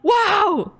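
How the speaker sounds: amazed. Real, not acted. Amazed at game moment.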